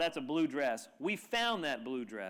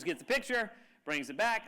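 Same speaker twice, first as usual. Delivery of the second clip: usual speaking voice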